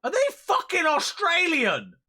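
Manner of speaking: loud, high disbelieving yell; stunned yell at the screen; shocked outburst. Real, not acted. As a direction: shout it in sheer disbelief. Streamer reacts to game accents.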